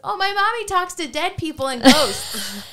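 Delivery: put-on high, loud little-kid announcement; high, loud child blurting out what mom does. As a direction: blurt it out like a proud little girl. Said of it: "pokes her head out of the car and goes".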